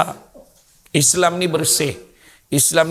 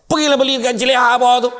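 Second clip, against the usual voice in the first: high, fast scolding; angry wife; 7 semitones higher, much faster than usual. Acted. Wife ordering husband to buy porridge downstairs, angry. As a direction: fire off an irritated order. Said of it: "Bini dia marah".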